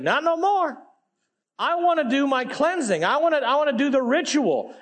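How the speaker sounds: a healed man pleading his case, mildly hammed-up; eager insistence. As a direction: insist eagerly, pitch lifted. Healed leper excitedly answering the priests.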